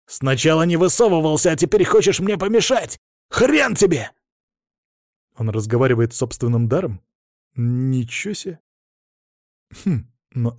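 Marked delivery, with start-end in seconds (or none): 0.1-4.1 high, furious yell; angry shouting. A narrator's reading. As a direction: rage at the devourer. Anton fighting his own power.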